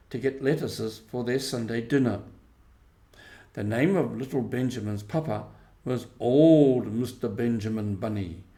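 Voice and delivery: usual speaking voice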